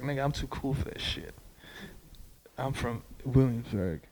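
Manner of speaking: mumbled lazy hipster voice, mouth barely moving; a hint of a too-cool hipster, soft and slow; Williamsburg voice. Imitating a Williamsburg hipster, soft mumble with still lips. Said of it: "That's my Williamsburg voice".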